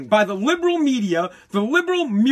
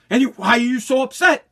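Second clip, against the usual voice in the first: ordinary delivery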